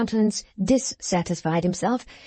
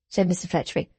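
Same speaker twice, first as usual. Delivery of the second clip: usual speaking voice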